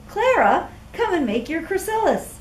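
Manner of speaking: calling out. Acted. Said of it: "Cornelius called".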